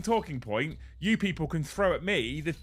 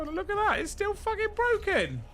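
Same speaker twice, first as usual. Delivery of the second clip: exasperated. Real, not acted. Tracker still broken, exasperated.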